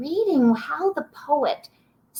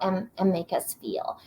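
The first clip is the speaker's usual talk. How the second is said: whisper; 4 semitones lower, 6 dB quieter than usual. Acted. Soft ending of a list.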